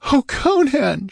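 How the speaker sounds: high, tearful cry of his name; sobbing. A narrator's reading. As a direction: sob out his name in distress. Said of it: "she sobbed".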